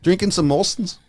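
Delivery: bad Canadian accent, eh; lightly hammed-up Canadian. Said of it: "Was that your Canadian accent?".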